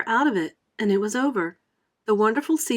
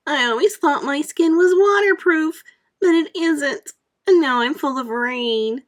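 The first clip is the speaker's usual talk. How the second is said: high, louder whining voice; whiny earthworm; 6 semitones higher, 4 dB louder than usual. Acted. Earthworm lamenting the rain, high whine. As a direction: whine like a gloomy worm.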